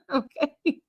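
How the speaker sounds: high laughing fit. Real, not acted. Laughing at the viewer's joke, high and helpless. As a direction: crack up at the joke.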